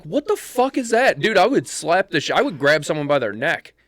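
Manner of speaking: high-pitched, incredulous outburst; acted outrage. Viewer retells reacting to the video of the sitter, outraged disbelief.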